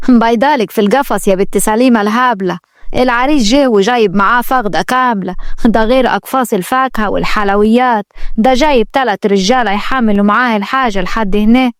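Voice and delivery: usual speaking voice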